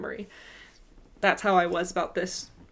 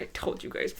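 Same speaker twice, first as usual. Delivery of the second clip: told-you-so whisper; 9 dB quieter than usual. Retold or imitated. Told-you-so after the outcome.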